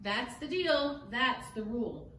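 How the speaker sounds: plain reading voice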